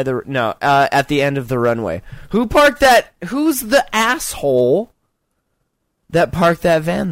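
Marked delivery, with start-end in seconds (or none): 2.3-4.9 indignant. Acted. Voicing the crashed pilot's outrage.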